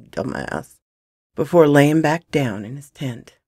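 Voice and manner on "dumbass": low, soft mutter; smirking mumble. Acted. Gunman muttering after the boy runs off, smirking mumble. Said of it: "smirked and mumbled".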